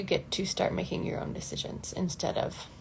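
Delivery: breathy whispered encouragement. Real, not acted. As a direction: lean in and whisper it.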